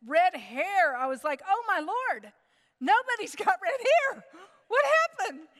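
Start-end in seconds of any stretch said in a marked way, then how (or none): 1.5-5.5 high, loud laughing disbelief; pitched-up startled cry; mock shocked mother. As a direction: gasp it out, half laughing. Mother retells shock at red hair.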